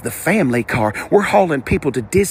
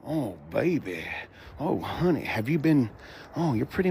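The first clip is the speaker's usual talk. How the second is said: soft admiring coo; 6 semitones lower, 10 dB quieter than usual. Real, not acted. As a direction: murmur it like you're in love with the car. Admiring the car up close.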